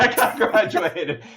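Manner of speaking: high, slow, helpless laughter on the words; laughing. Real, not acted. Actor agrees his British guy graduated, laughing. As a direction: echo the joke while cracking up. Laughing along with host's joke.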